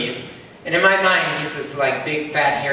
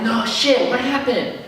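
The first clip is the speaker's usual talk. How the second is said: mock shocked horse, pitched up; a horse gasping at the gossip, pitched up; surprised horse; 7 semitones higher than usual. Horse friend hears the news, mock shocked and higher. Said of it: "they'll be like".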